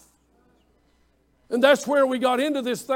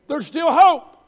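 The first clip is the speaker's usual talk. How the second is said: loud, raised preaching cry; punchy, full-voiced pulpit declaration; emphatic proclamation; 4 semitones higher, 8 dB louder, slower than usual. Real, not acted. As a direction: proclaim it high and loud to the crowd.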